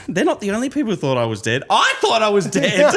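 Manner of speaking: high-pitched retold quip breaking into laughter; laughing punchline. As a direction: build to a loud, laughing finish. Nan's punchline, teller cracking up.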